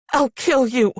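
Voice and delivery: high, forceful threat; threat in earnest. A narrator's reading. Pinned woman threatening her captor, high earnest threat. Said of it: "I said, and meant it".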